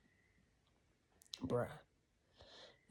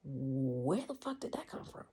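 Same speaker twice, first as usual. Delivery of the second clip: disbelief. Retold or imitated. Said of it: "I looked at the bucket and said".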